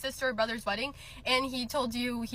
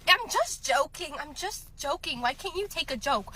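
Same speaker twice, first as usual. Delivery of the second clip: high, drawn-out imitation of whining; put-on whiny protest, stretched words; mocking whine; 5 semitones higher than usual. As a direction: drag out the excuse mockingly. Mocks boyfriend's excuse after bullying.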